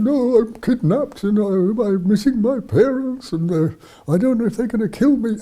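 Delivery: high-pitched mock-distressed girl's voice; retold tearful plaint. Kidnapped girl tells the bearded stranger her troubles, retold tearful plaint. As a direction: plead like a kidnapped child, pitched well up. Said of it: "the poor girl was crying and crying".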